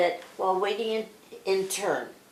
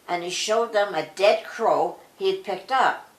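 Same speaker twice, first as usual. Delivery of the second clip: plain reading voice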